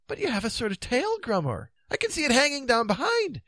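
high-pitched puzzled king; high, dithering character voice; Pellinore, baffled. Acted. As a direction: ask high, in innocent bewilderment.

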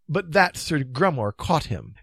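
usual speaking voice

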